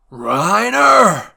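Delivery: long, very loud shout; battle shout. A narrator's reading. Levi diving at Reiner, loud battle shout. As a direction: bellow his name as you strike.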